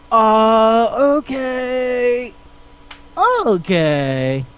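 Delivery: drawn-out, high, uneasy okays with long pauses; acted awkward, stunned reaction; weirded-out acceptance. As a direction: stretch each word in mock discomfort. Acted stunned reaction to her confession.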